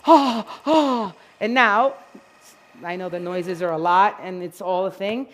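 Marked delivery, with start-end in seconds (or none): 0.1-1.1 loud, excited yelps; excited dog. Acted. Groomer voicing an excited dog in the bath, loud. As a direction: voice the thrilled dog.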